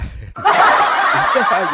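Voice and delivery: loud burst of real laughter. Real, not acted. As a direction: crack up loudly. Laughing at himself. (tawa keras yang lepas)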